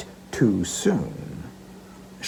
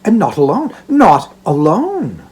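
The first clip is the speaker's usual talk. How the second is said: put-on smiling girl; 6 semitones higher, 6 dB louder than usual. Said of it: "smiling as she spoke".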